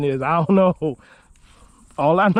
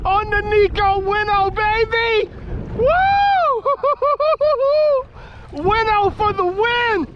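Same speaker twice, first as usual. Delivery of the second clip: high, shrieking joy at a huge catch; giddy high-pitched whoops; ecstatic yelling; slower than usual. Real, not acted. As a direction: scream it out like you just landed the fish of your life. Big catch reeled in.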